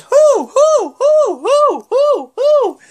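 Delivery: loud, very high hoots; high rhythmic hooting. Retold or imitated. Mimicking the New Day's chant, high hoots. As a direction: hoot it like a chanting crowd. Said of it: "then they're like".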